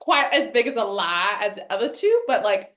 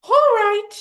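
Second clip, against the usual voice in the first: much higher, loud ghostly all right; 11 semitones higher, 12 dB louder than usual. Acted. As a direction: shriek it like the unmasked ghost. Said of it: "And the ghost goes".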